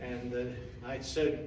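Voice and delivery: usual speaking voice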